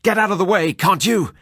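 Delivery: frantic shout to clear the way. Acted. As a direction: yell him out of your path.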